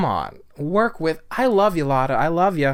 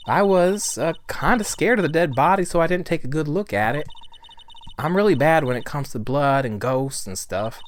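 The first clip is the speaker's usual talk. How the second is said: animated, lilting witness voice; lively witness; 4 semitones higher, 4 dB louder than usual. Acted. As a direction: play a chatty witness explaining herself.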